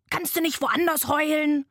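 high, irritated sloth complaint; angry shout. A narrator's reading. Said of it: "rief es wütend".

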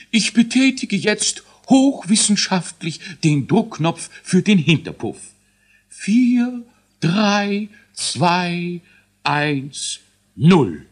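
high, squeaky scientist dwarf counting down; a fussy little boffin announcing launch; learned dwarf voice. A narrator's reading. Dwarf scientist starts the rocket countdown, high and squeaky. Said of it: "da rief Elisius, der hochwissenschaftliche Zwerg".

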